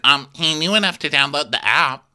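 high, loud snarky dig; snarky retort. Retold or imitated. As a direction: fire back with sass. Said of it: "she's like".

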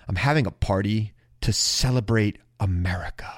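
quoting the conservative friend's invitation in a whisper; whispered quote. Retold or imitated. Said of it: "one of my friends said to me".

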